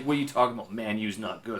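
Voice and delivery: ordinary delivery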